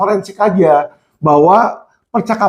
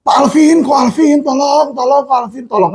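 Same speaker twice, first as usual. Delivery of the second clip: higher, pleading inmate on the phone; begging inmate; 7 semitones higher than usual. Retold or imitated. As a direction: beg for help like a scared prisoner. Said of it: "kenalan-kenalan saya di rutan, di lapas itu nelfon saya". (memohon panik seperti tahanan yang menelepon)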